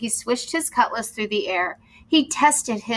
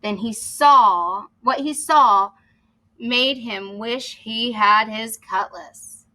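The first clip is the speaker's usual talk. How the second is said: plain reading voice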